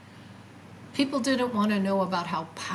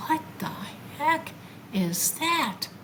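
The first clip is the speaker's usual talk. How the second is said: higher, slowed and puzzled; bewildered; 8 semitones higher, slower than usual. Retold or imitated. Driving home and spotting a UFO, bewildered. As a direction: stare at it, drawing the words out. Sees strange object over tracks.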